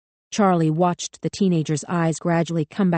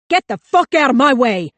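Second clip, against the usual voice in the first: higher, much louder, frantic yelling; panicked scream; 7 semitones higher, 8 dB louder than usual. A narrator's reading. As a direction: scream it with the gun raised.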